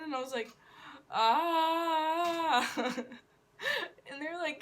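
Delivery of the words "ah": loud, high mock zombie scream. Applicant asked to scream again, loud mock zombie scream. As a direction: hold a loud "aah" like an amateur zombie. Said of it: "Can you scream like a zombie again?".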